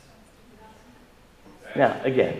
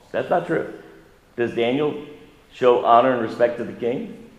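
usual speaking voice

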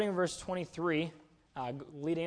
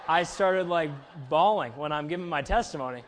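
excited telling; 3 semitones higher, 10 dB louder than usual. Real, not acted. Retelling bawling during testimony.

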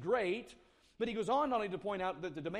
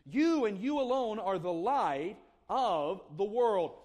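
louder and slower, "You" and "alone" hammered; emphatic statement; 2 semitones higher, 6 dB louder, slower than usual. Shown as a demonstration. Preacher driving the point at his listeners, slow, loud emphasis on "You". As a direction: press every word home, slowly. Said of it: "uses the emphatic statements".

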